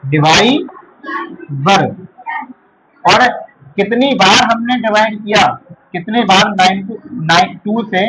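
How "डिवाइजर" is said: very slow, split DIVAI - ZAR; syllable by syllable. Shown as a demonstration. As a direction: break "divisor" with a long gap before the last syllable. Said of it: "वो कहलाता है".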